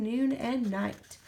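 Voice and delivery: ordinary delivery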